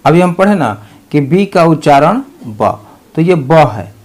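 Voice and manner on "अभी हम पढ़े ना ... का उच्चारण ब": ordinary delivery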